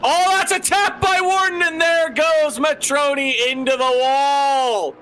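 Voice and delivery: high, loud excited crash call; excited shout. Real, not acted. Crash called live.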